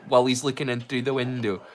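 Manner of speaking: usual speaking voice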